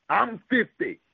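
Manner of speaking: ordinary talk, nothing marked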